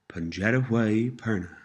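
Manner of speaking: slightly slowed, careful model phrase; measured teaching pace. Shown as a demonstration. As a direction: say it a little slowly for learners. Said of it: "Pronounced a little slower".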